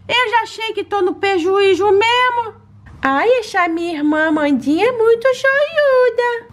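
high, sweet little pet voice begging for treats; baby-voiced pet. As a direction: talk like a greedy cute puppy asking mommy. Lilo chooses flavor.

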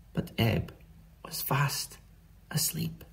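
soft, breathy, spaced-out words; hushed whisper. A narrator's reading. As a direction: whisper so as not to wake the dog. Sleeping dog, story winding down.

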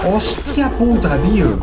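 real scare caught on mic; real fright. Real, not acted. Player startled by a horror game scare, slow frightened outcry.

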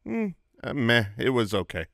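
low, noncommittal shrug of an answer; indifferent meh. Shown as a demonstration. Friend asked about last night's movie, indifferent meh. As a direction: drop the pitch and sound unimpressed. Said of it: "have to respond with meh".

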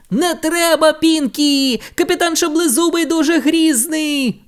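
alarmed cry. A narrator's reading. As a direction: beg Pinky to stop. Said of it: "закричав він". (тривожно вигукує)

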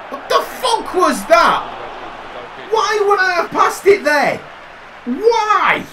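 slow stunned swear climbing to a high angry yell; furious disbelief. Real, not acted. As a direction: drag out the outrage, then shout why. Raging at a misdirected pass.

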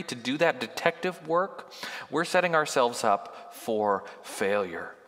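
ordinary talk, nothing marked

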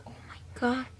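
breathless whispered oh my God; hushed, amazed whisper; awed whisper. Real, not acted. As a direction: whisper it in awe at the colours. Awed by how the makeup looks.

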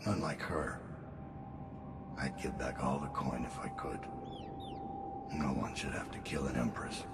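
barely above a breath, still and cold; very hushed. Acted. Assassin bidding the dead Regent farewell, very hushed. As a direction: let the words sink almost to nothing. Same lines, softer take, music under.

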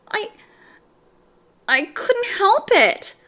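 put-on caught-out boy pleading helplessness; sheepish excuse. A narrator's reading. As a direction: stammer out a feeble defence. Paul excuses himself to teacher.

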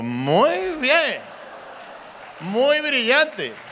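high, drawn-out mock admiration; slow, exaggerated fake approval; sarcastic praise. Mock praise of the presenter's idea.

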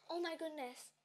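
ordinary talk, nothing marked

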